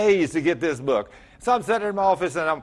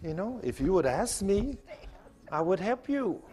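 softer, patient and kind; gentle voice of God; 4 semitones higher, 6 dB quieter than usual. Pretend. Pastor imagining God quietly waiting to be asked. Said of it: "Was God sitting there all the time saying".

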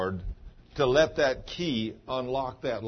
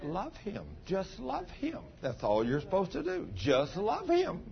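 soft, high, tender, slowing at the end; gentle voice of the Lord; 7 semitones higher, 4 dB quieter than usual. Retold or imitated. Pastor voices the Lord answering Cheryl, soft and tender. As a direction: speak it gently as God to a hurting wife. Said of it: "the Lord just spoke to her just as clear".

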